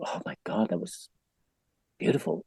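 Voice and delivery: very soft, breathy amazement; hushed retold awe. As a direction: murmur it in wonder, with pauses. Retold awed reaction of participants.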